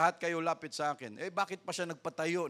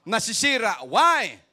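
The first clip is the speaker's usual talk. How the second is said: loud climax; 5 semitones higher, 10 dB louder, slower than usual. Shown as a demonstration. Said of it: "Relasyon ng kung ano-ano pa, nasisira".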